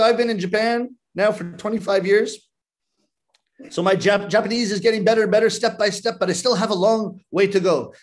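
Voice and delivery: normal talking voice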